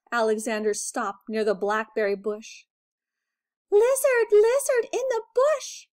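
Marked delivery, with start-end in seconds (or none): 3.7-5.9 high, loud urgent call; summoning the lizard in a hurry. A narrator's reading. Breathless mouse calling the lizard by the bush, loud. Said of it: "he called quickly".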